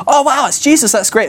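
high-pitched gush of delight; mock excitement. The other disciples spotting Jesus, hammed-up excitement. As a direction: gush it like a thrilled onlooker. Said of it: "He's so excited".

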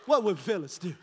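chuckling through the words; amused repeat; laughing. Real, not acted.

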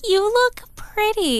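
high, slow, sugary reassurance; sweet high voice. Retold or imitated. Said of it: "She's like".

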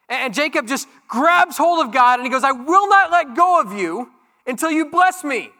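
loud, high, driving sermon voice; full-force preaching. Acted. Pastor to congregation on Jesus calling out goodness, full-force preaching. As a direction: preach it out with rising fire. Preaching Jacob wrestling story.

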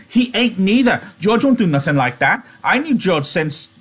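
high-pitched belligerent protest; high, stammering defiance. A narrator's reading. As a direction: argue back hotly in a high voice. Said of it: "Lenny retorted belligerently".

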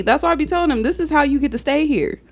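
scolding mother. Retold or imitated. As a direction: lay down the law to the kids. Mother lecturing kids about chores.

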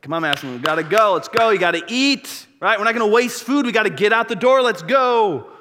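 loud, high, hurrying her along; impatient hurry. Retold or imitated. Dad rushing his daughter to eat and leave, loud and impatient.

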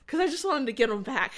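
laughing confession of spite. Real, not acted. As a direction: chuckle at your own pettiness. Laughs at her own petty revenge.

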